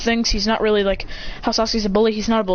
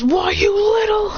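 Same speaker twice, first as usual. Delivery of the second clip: high, slow, rising outrage; outraged shout; 11 semitones higher, 4 dB louder, slower than usual. Acted. Ramen seller going for the demon, outraged. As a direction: shout it drawn out, pitch climbing.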